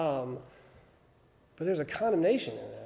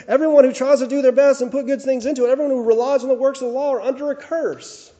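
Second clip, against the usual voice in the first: loud, fast preaching of the verse; preacher reading the verse at full force; preaching quote; 6 semitones higher, 10 dB louder, faster than usual. Acted. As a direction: proclaim the law's verdict with force. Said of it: "and it says".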